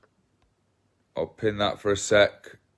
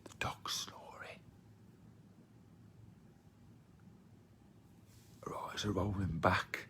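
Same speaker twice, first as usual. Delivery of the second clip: hushed whisper so the dog sleeps on; 3 semitones lower, 20 dB quieter than usual. Real, not acted. Talking to viewers beside a snoring dog, whispering. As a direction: breathe the words without voice.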